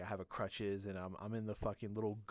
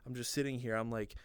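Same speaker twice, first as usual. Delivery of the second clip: ordinary delivery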